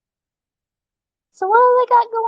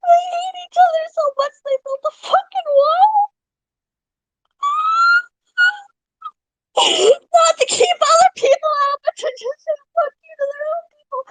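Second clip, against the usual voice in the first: words breaking up with real laughter, higher; laughing disbelief; 8 semitones higher than usual. Real, not acted. Streamer laughing at walls built between neighbors, laughing. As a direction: laugh through it, can't believe it.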